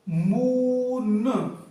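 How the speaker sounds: मून drawn out very slowly, long ऊ; slow demo reading. Shown as a demonstration. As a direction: stretch मून for the class. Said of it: "देखिए". (मून को धीरे-धीरे खींचकर)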